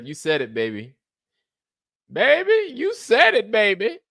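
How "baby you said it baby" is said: loud, very high catchphrase voice; high falsetto. Acted. Comic repeats a catchphrase for laughs, loud high falsetto.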